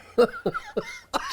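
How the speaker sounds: shrieking laugh up high. Real, not acted. Comedian laughing at his own story, high shriek.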